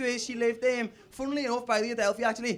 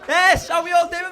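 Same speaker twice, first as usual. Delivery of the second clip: loud, higher warm-up; crowd hype shout; 6 semitones higher, 12 dB louder, slower than usual. Real, not acted. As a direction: get the room shouting back. Comic warming up crowd.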